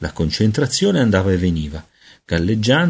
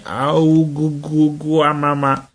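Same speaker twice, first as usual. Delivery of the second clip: put-on high, slow baby gurgling; baby babble; 7 semitones higher, slower than usual. A narrator's reading. Peter's words coming out as infant babble, high and slow. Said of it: "uscì soltanto qualcosa come".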